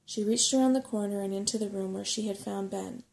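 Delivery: usual speaking voice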